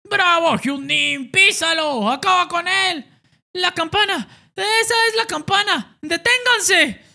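high, loud ringside yelling; high excited cheering teammate. Acted.

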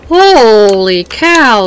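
amazed. Real, not acted. Finds a room full of monitors.

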